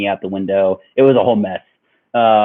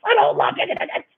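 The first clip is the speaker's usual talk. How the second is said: whiny yell; faster than usual. Retold or imitated. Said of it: "retorting to girlfriend in car".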